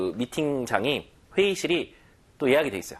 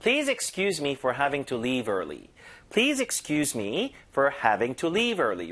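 slow model read; much slower than usual. Shown as a demonstration. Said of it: "그대로 외워서 쓰세요".

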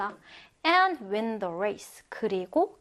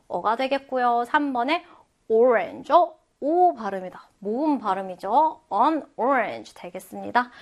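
normal talking voice

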